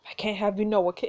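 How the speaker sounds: normal talking voice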